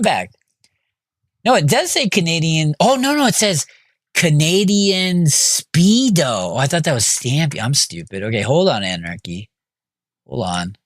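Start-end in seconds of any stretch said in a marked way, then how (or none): 4.1-6.5 slow realization. Real, not acted. Finally reading the name right.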